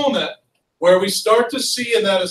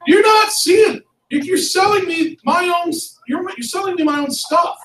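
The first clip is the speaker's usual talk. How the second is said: high-pitched acted outrage; hammed-up aggrieved complaint, pitched high; indignant protest; 8 semitones higher than usual. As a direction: protest as if cheated. Acting out an aggrieved colonist's protest.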